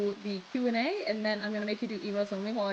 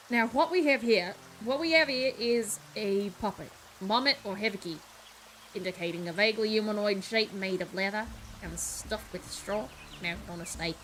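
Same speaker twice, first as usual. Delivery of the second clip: British Cockney putting on posh; Cockney trying posh; 3 semitones lower than usual. Acted. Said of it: "British Cockney trying to sound posh".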